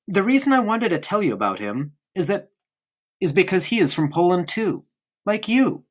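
put-on a small boy prattling on to his friend; young boy Bruno. Bruno voice again.

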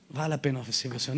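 whispered conviction. Real, not acted. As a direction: let Vale a pena servir ao Senhor fall to a hush. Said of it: "softer repeat".

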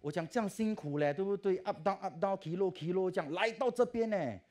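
loud, high, exasperated scolding; angry complaint to God. Retold or imitated. Law student scolds God before exam results, loud and angry. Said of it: "我就很生气嘞".

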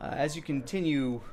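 ordinary talk, nothing marked

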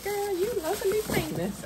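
high, light imitation of the cashier; lightly voiced friendly cashier; a hint of a cheerful clerk. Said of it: "She was like".

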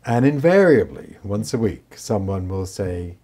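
ordinary talk, nothing marked

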